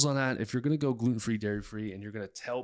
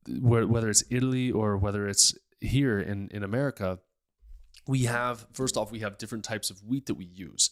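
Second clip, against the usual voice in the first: ordinary talk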